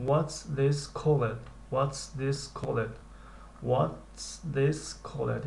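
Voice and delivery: very slow, careful drilling; slow model question. Shown as a demonstration. Teacher drills the question slowly for students. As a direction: repeat it slowly for learners. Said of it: "teacher drills question".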